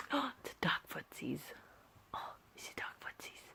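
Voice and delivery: hushed little whisper to a pet; whispered cooing. Real, not acted. Owner fussing over the dog's feet, whispered cooing. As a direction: whisper fondly, very softly.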